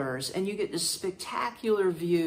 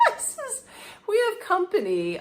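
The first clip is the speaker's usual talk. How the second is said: laughing; 11 semitones higher than usual. Real, not acted. Laughing at absurd situation.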